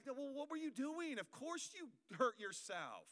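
high, slow exasperated scolding; mock bad cop. Dad lecturing hurt kid, high scolding. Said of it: "being like".

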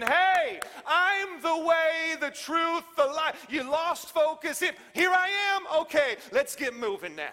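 loud, high rallying shout as Jesus; calling out. Acted. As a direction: call out to a lost crowd, drawn out. Preacher voices Jesus calling out.

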